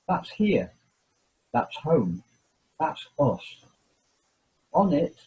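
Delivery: measured, weighty reading of a famous passage, pausing between phrases; solemn reading. Acted. Reads Sagan quote aloud.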